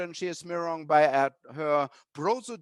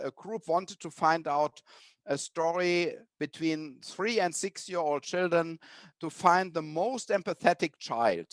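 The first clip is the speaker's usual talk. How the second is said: ordinary delivery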